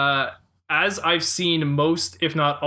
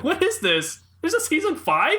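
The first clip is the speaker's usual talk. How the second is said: disbelieving; 12 semitones higher than usual. Retold or imitated. Retold disbelief.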